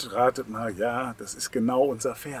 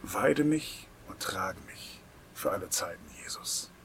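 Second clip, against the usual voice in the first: softly whispered plea to Jesus; whispered prayer; 4 semitones lower, 6 dB quieter than usual. Shown as a demonstration. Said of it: "Das ist ein Übergabegebet an Jesus". (geflüstertes Gebet)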